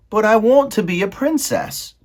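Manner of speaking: put-on high, loud servant-girl voice; Meg's voice. Maid Meg answering Roscuro, high and loud. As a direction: squeak it out as the simple maid.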